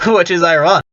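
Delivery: giggling. A narrator's reading. Father telling his son a funny family story, giggling. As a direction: let a little giggle carry the words up. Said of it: "giggling a little".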